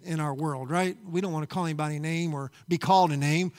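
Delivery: ordinary talk, nothing marked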